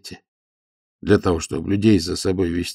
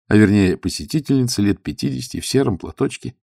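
ordinary delivery, nothing marked

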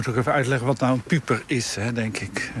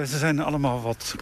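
ordinary talk, nothing marked

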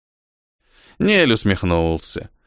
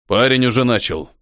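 usual speaking voice

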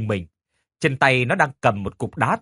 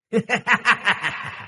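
evil cackle; 9 semitones higher than usual. Acted. Ghost laughs.

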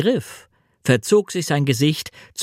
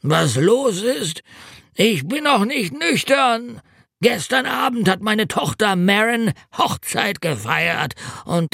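hungover road mender; 8 semitones higher than usual. A narrator's reading. Road mender telling narrator of the wedding binge, high drunken lament.

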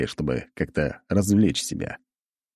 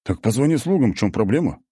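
surprised; 5 semitones higher than usual. A narrator's reading. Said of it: "удивился один из них".

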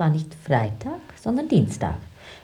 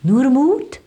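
high, loud call of courage; rally him with a loud high cry; loud encouragement; 5 semitones higher, 9 dB louder than usual. Acted. Urging her man on before the SS, loud and high.

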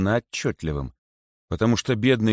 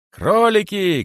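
high, loud rallying call; shouting; 13 semitones higher, 6 dB louder, slower than usual. A narrator's reading. Rabbit calls from inside the boa, shouting. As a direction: cry out to the crowd, pitched high. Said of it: "кричал он".

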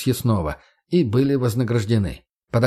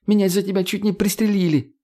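indignant high yell; 9 semitones higher than usual. A narrator's reading. Margo continues yelling.

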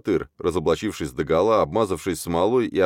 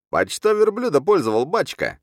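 very high, shrieky accusation; spiteful yelling; 11 semitones higher, 3 dB louder than usual. A narrator's reading. Grudge-bearing wild man heckling the khan, yelling. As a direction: yell it as a heckler. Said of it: "орал злопамятный Мастур Батыр".

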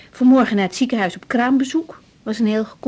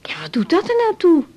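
high, louder exasperated retort; indignant; 7 semitones higher, 4 dB louder than usual. Acted. Doctor arguing with the bed clerk on the phone, indignant. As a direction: snap back in indignation.

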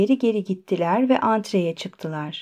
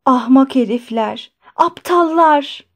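rising to a higher, drawn-out last word; angry repeated cursing; 3 semitones higher, 3 dB louder than usual. A narrator's reading. Doctor alone after guests left, cursing angrily. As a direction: curse after them, mildly then harder. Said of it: "tekrar edip durdu".

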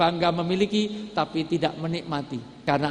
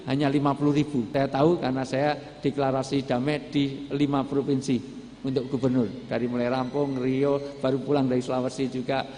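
normal talking voice

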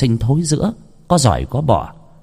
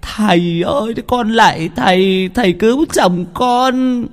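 wailing wife; 12 semitones higher than usual. A narrator's reading. Wife kneels at the healer's feet, wailing lament. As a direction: wail and beg for your husband. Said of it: "Bà Lý khóc nức nở".

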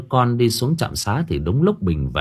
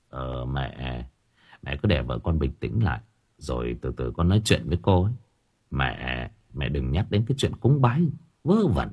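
much lower, softer male voice; skeptical son; 7 semitones lower, 5 dB quieter than usual. Acted. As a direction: brush off mother's ghost talk firmly. Son dismisses ghost talk.

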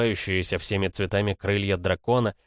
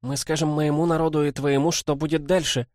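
put-on higher-pitched girl's voice; determined girl; 8 semitones higher than usual. A narrator's reading. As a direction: declare the plan as a determined girl. Girl character declaring a plan. (решительный голос девочки, высоко)

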